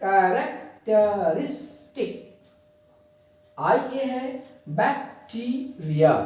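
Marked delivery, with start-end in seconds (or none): 0.0-2.1 कैरेक्टरिस्टिक slowly split into ka, rak, te, ris, tik; slow, spaced syllables in कैरेक्टरिस्टिक. Shown as a demonstration. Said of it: "अलग-अलग syllable को मैंने line खींच दिया". 4.7-6.1 बैक्टीरिया slowly split into bak, tee, ria; slow, spaced-out syllables in बैक्टीरिया. Shown as a demonstration. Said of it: "अलग-अलग syllable को मैंने line खींच दिया".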